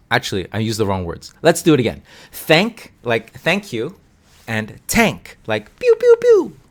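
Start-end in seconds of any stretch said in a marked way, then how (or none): 2.3-2.9 "Thank" with a clear th, higher pitched; isolated, carefully modelled "Thank"; minimal pair demo. Shown as a demonstration. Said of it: "let's use a minimal pair". 4.9-5.4 "tank" with a crisp t; carefully modelled "tank", t instead of th; minimal pair demo. Shown as a demonstration. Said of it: "let's use a minimal pair". 5.8-6.6 very high, loud toy-cannon shots; imitated tank fire; high "pew pew" gunfire. Shown as a demonstration.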